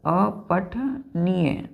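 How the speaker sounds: drawn-out slow अपठनीय; teacher drawing out the word; slow spelled-out word. Shown as a demonstration. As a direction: stretch अपठनीय very slowly. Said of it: "eligible का मतलब है बच्चों अपठनीय".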